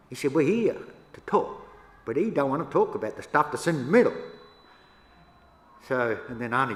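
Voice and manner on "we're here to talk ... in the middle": a retold loud complaint in a tense meeting; firm complaint. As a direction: press the point hard. Quoting the frustrated meeting talk.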